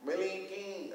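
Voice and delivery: high, stretched-out name call; Lee Daniels. Retold or imitated. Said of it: "that's how he talks".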